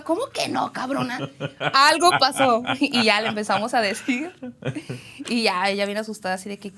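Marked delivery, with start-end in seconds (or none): 0.0-2.6 raised, indignant retort; loud retold scolding, insistent; scolding mother. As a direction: imitate a mom who is not buying it.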